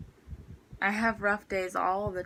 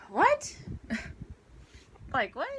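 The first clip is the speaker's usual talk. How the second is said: high, stunned what; can't believe what she's hearing; incredulous what; 5 semitones higher than usual. Retold or imitated. Student to teacher about the lab, stunned and incredulous.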